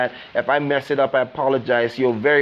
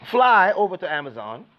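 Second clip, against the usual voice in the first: normal talking voice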